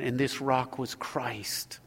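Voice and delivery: hushed, slow whisper on "Christ"; reverent whisper. Acted. Pastor revealing Christ in the psalm, in a slow hushed whisper. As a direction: breathe it out softly, in awe.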